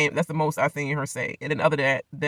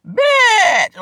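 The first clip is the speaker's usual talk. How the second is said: sudden shout far higher and louder; reenacted yell; 12 semitones higher, 9 dB louder, much slower than usual. [name] reenacting Jay's moment, a loud mocking yell. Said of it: "she reenact the whole scene".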